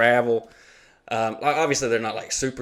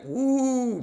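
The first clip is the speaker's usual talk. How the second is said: high falsetto woo; a quick hammed-up cheer; mock cheer; 13 semitones higher than usual. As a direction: squeal like a parent. Imitating cheering parents.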